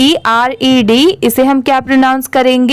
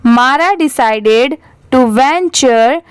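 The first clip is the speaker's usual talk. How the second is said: slow, measured model reading with pauses; repeat-after-me reading; much slower than usual. Shown as a demonstration. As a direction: read slowly, leaving gaps for the class to echo. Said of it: "मेरे बाद आप repeat करिए". (रुक-रुककर धीमा आदर्श वाचन)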